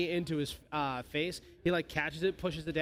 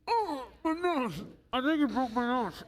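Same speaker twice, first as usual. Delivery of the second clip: bugbear whining in pain, pitched up; whiny bugbear; 7 semitones higher than usual. Acted. Bugbear with broken nose.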